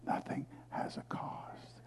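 slow hushed whisper; slow sermon whisper to lean the room in. Real, not acted. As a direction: drop to a reverent whisper.

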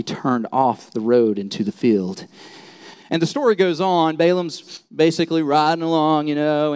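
ordinary talk

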